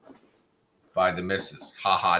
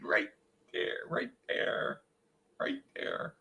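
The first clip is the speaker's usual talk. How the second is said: whisper; 10 dB quieter, slower than usual. Real, not acted.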